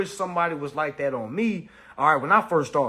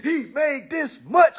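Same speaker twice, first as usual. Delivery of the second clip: mock hater shout; 9 semitones higher, slower than usual.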